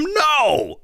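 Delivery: high crying shout of no; a stricken wail of refusal; horrified no. Real, not acted. Streamer wails at chat's glitter bomb idea, horrified.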